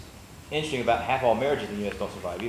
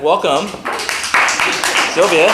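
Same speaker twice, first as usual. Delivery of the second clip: louder and higher call to the crowd; loud welcome; 5 semitones higher, 11 dB louder than usual. Real, not acted. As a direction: announce her name to the room. Host welcomes speaker to stage.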